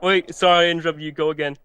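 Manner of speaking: normal talking voice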